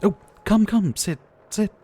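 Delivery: high and loud, hurrying the guest to sit; bright, insistent come-in; eager welcome. Acted. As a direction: fuss over a soaked friend. Ushering guest in from rain.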